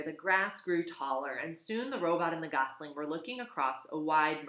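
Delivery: ordinary delivery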